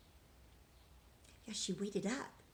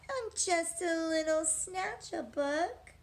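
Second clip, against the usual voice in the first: mournful mumble; 7 semitones higher, 4 dB louder, slower than usual. Acted. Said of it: "He mumbled with a mournful look".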